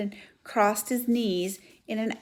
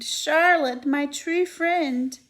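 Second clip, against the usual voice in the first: moaning; 6 semitones higher, 5 dB louder, much slower than usual. Acted. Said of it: "he moaned".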